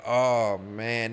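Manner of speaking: ordinary talk, nothing marked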